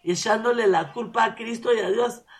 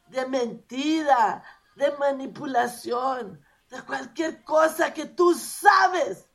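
rising higher and louder, item by item, to a shout; building to a shout; 4 semitones higher, 4 dB louder, slower than usual. Acted. Preacher voicing a sinner's confession to God, building to a shout. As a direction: pile up the sins until she's crying out.

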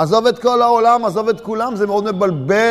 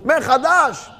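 higher cry that falls away; exclaimed dismay; 5 semitones higher, much slower than usual. Acted. Man learns everything starts over, exclaiming in dismay. Cries out after listing the restart.

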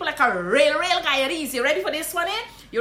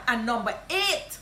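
ordinary delivery

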